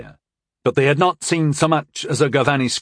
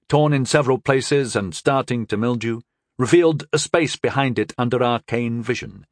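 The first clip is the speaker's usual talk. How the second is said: usual speaking voice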